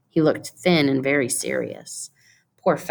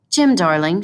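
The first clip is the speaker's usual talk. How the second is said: higher, louder cry of his name; cried out; 4 semitones higher, 5 dB louder than usual. Acted. Della begs her husband not to look at her that way, pleading cry. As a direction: call to him, pleading. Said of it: "she cried".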